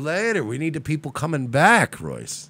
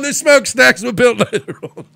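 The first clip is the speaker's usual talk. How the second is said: laughing shout; 9 semitones higher, 7 dB louder than usual. Acted. Repeats the rant laughing.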